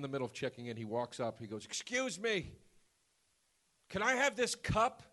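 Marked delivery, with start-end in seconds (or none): 1.6-5.0 high-pitched impression of an elderly man; mock old man. Old man interrupting a hotel check-in, high mock-elderly voice. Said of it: "this ninety-year-old man walks in".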